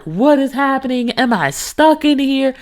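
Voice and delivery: high, retold panicked questions; freaking out. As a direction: wail it like someone trapped, hammed-up. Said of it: "I start freaking out".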